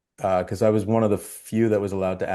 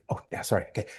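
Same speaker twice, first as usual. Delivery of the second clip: whispered apology; whispered prompt; 8 dB quieter, much faster than usual. Retold or imitated. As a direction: hush it under the breath. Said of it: "and I go".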